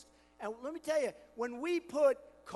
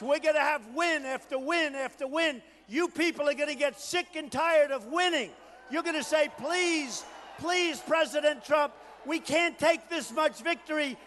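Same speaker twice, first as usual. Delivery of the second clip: rally preaching; 5 semitones higher, 7 dB louder than usual. Acted. Candidate rousing the Oklahoma crowd, loud shouted rally. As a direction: whip the crowd up, full force.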